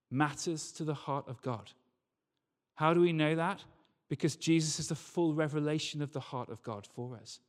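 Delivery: ordinary talk, nothing marked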